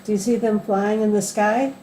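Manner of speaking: usual speaking voice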